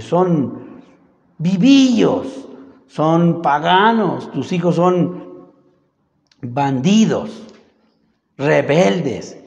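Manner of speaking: each insult drawn out slow and pressed; slow accusation. Acted. Townspeople listing the sons' sins to old Samuel, slow and pointed. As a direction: spell out every charge slowly, word by word. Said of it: "le dijeron".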